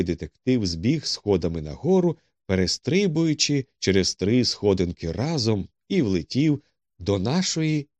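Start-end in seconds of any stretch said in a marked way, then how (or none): none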